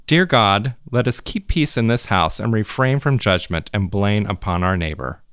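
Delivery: ordinary talk, nothing marked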